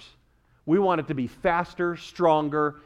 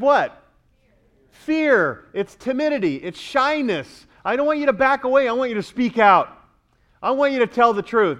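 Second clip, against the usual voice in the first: high, urgent exhortation; impassioned preaching; 7 semitones higher, 5 dB louder than usual. Real, not acted. Preacher urging listeners to speak out, impassioned. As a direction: drive each point home with force.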